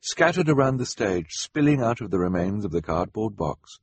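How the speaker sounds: usual speaking voice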